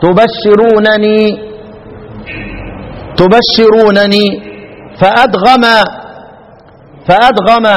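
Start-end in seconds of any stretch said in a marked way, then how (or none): 0.0-4.3 slow تبشرونني with two nuns; original form. Shown as a demonstration. Teacher shows the original form slowly. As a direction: draw out تبشرونني, both nuns clear. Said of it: "الاصل".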